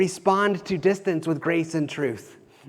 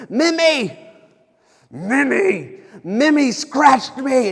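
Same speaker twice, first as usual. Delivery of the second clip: put-on loud, high little-kid wail; upset four-year-old; 8 semitones higher, 7 dB louder, much slower than usual. Dad imitating his son scratched by the pug, upset wail. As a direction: cry out like a wronged child, drawn out. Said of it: "he's so upset about it".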